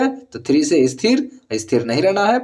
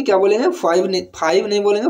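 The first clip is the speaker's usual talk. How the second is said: usual speaking voice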